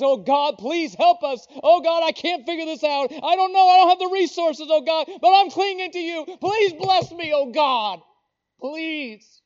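loud, high-pitched pleading to God; passionate prayer. Acted. Believer begging God for help and blessing, passionate prayer. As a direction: cry out desperately in prayer, building, then easing on the last word. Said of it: "You ought to pray with passion".

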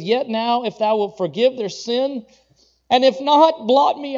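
rising, crying-out prayer; desperate plea. Acted. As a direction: beg to be blotted out instead of them. Moses begging God for the people.

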